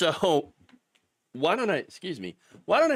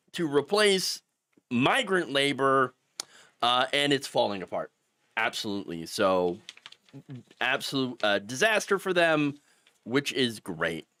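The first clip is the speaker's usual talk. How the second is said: normal talking voice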